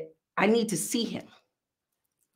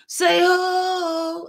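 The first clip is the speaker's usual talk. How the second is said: high, loud hype-man call; 10 semitones higher, 5 dB louder than usual. Retold or imitated. Rapper calling out to the club crowd, high and loud. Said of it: "I'm like".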